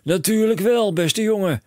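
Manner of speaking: high and loud, racing with plans; a dad who sees a fortune; excited cry. A narrator's reading. Father telling his son of riches, high excited call. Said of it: "riep meneer Krakeel".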